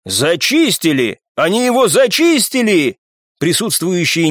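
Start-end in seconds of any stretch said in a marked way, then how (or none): 0.0-3.0 drawn-out cry climbing high; amazed shout, building in pitch; astonished exclamation. A narrator's reading. Machine gunner seeing the rift cleared, astonished. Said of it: "изумлённый возглас одного из пулемётчиков".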